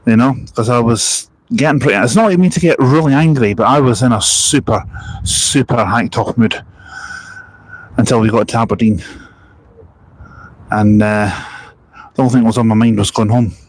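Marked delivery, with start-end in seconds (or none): none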